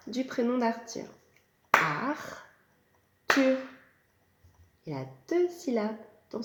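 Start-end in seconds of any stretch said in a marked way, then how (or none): 1.7-3.6 Ar-thur split into clapped beats; syllables tapped out. Shown as a demonstration. Teacher to preschoolers, chanting the name in syllables. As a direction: chant it beat by beat for the kids. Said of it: "On tape les syllabes".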